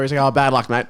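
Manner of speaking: ordinary talk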